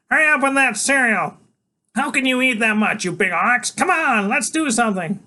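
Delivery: put-on small boy waking his big friend for a quest; eager boy genius. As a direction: rouse him like a tiny herald. Freak voiced again.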